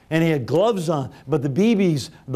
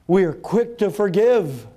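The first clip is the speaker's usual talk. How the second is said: preaching; 7 semitones higher than usual. Acted. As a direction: drive the appeal home to the congregation. Preaching to the room.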